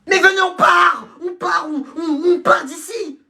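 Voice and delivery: high, slow yelled call to leave; rally the class out the door; shouting. Acted. A pupil urging classmates to leave before the teacher comes, shouting.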